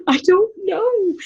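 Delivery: high, slow mock-sobbing whine; imitated teenage crying. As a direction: wail it like a weeping teenager. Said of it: "I can't stop crying".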